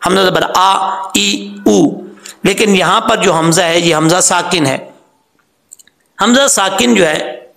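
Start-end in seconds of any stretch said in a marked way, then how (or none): 0.0-2.0 आ, ई, ऊ each with a hard glottal catch; hard hamza demo. Shown as a demonstration. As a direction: recite the vowels crisply, stopping in the throat. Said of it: "सख्ती से पढ़ा जाता है, उसकी आवाज़ है जैसे".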